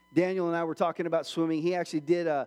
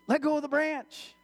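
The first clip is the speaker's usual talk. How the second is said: higher, sustained call from above; insistent heavenly voice; 9 semitones higher than usual. Acted. God answers the frantic man on the cliff, insistent call. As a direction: repeat God's order, pitched up and projected. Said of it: "he hears this voice".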